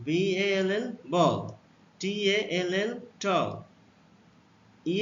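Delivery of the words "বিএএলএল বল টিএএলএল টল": slow demo reading. Shown as a demonstration. Teacher slowly blending letters into words for pupils. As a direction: spell then blend, very slowly. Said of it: "এর উচ্চারণ অ হলে".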